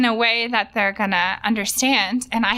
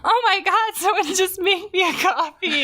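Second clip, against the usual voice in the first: higher, laughing mock delight; mock thrilled; 8 semitones higher than usual. Retelling overjoyed reaction to coffee, laughing. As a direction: gush over it like a miracle. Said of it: "you're like".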